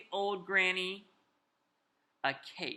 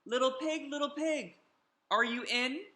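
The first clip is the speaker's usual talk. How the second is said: calling out; 7 semitones higher than usual. Acted. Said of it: "so I called".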